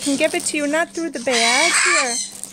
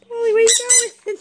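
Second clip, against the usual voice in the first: high sing-song call; 10 semitones higher than usual. Real, not acted. Owner calling after her parrot Polly, high sing-song.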